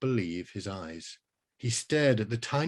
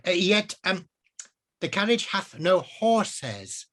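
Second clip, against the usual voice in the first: wizard voice; 8 semitones higher, slower than usual. Acted. Wizard explaining cars to himself, high and archaic. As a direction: pronounce it like a medieval sage.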